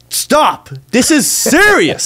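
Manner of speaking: high, slow, pleading stop; high exasperated plea. Retold or imitated.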